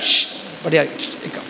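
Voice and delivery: hushed whisper, barely voiced. Shown as a demonstration. Monk shows how to quietly manage the temple crowd, whispered. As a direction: whisper it softly to calm the crowd. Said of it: "ऐसे करो".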